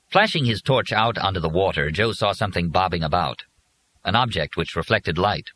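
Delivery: plain reading voice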